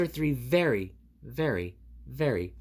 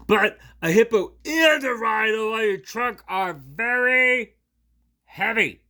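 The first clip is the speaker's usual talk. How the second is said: louder, higher, slow strained yell; straining shout; 9 semitones higher, 10 dB louder than usual. Acted. Gerald groans under animals, strained shout. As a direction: bellow under the weight.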